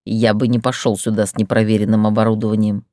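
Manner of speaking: a proud man bristling at doubt; offended man. Acted.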